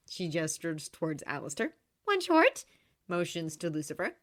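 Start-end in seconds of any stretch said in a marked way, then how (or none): 2.0-2.6 put-on high, bright girl's voice; bubbly young woman; cheerful young woman voice. A narrator's reading. Charlie voiced.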